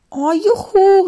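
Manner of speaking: high, loud cheer; joyful cry. A narrator's reading. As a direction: shout it out with delight. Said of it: "rief Charlotte".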